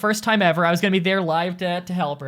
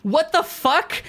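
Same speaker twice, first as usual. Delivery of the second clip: slow, drawn-out higher disbelief; real startled swear; stunned what the fuck; 7 semitones higher, much slower than usual. Real, not acted. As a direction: let it drag out in shock. Reacts to game event.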